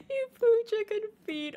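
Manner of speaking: high squeal full of laughter; loud, high, laughing repeat; tickled disbelief. Real, not acted. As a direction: squeal it, helpless with amusement. Laughing repeat of chat's line.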